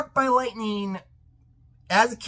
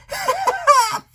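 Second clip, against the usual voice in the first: high, mocking cackle; derisive laugh; 3 dB louder than usual. Viewer reacting to rumor of a spin-off, derisive laugh. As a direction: burst of scornful laughter.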